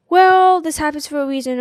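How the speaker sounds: bright preachy platitude voice; chirpy, preachy, dismissive; mock cheerful platitude.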